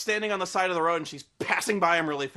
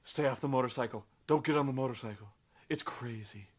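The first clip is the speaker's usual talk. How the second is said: lower, softer, quick ghost warnings; low ghostly nagging; 6 semitones lower, 4 dB quieter than usual. Retold or imitated. Ghost of Edward pleading with Bella, low and quick. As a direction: hurry the warnings low and soft. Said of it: "the ghost of Edward's like".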